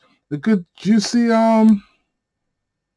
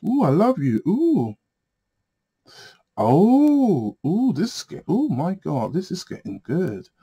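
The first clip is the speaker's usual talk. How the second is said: titillated; 6 semitones higher, slower than usual. Real, not acted. Cooing over juicy tarot reading.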